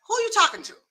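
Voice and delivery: high-pitched, pointed question; pointed challenge. Acted. Listener checking someone who is venting, pointed. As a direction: throw it back at them, high.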